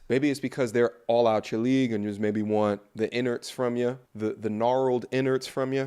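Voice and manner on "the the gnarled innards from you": ordinary delivery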